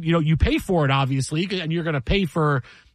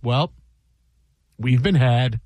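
wry anchor calm; 5 semitones lower than usual. Retold or imitated. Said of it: "he smiles. He goes".

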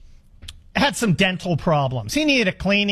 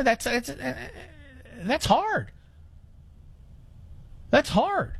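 choked up; 5 semitones higher, 6 dB quieter than usual. Real, not acted.

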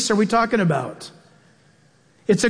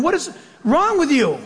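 higher, laughing exasperation at the machine; exasperated; 6 semitones higher than usual. Retold or imitated. Pastor scolding his confused GPS while driving, exasperated and amused. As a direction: throw up your hands at the GPS.

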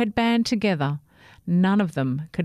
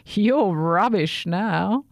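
slow, louder, scornful put-down; grumpy; 2 semitones higher, 4 dB louder, slower than usual. A narrator's reading. Grumpy shampoo bottle sneering at the new toothbrush. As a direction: sneer it out slowly. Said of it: "the grumpy shampoo bottle".

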